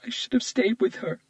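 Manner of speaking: tearful witness blaming herself; faltering, tearful. Acted. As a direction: voice cracking with guilt. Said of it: "her voice faltered".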